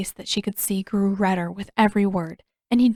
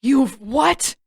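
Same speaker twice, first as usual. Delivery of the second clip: sharp high angry outburst; outraged; 5 semitones higher, 6 dB louder than usual. A narrator's reading. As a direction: demand it in disbelief, loud. Zuko outraged at soldier.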